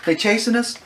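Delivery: high, loud urgent questions; mock panic. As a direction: act out alarm at being chased. Acted panic in the getaway car.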